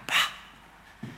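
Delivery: breathless whispered boah; whispered awe. Retold or imitated. Beginner looking up to a believer, whispered awe. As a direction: let the boah fade into a whisper.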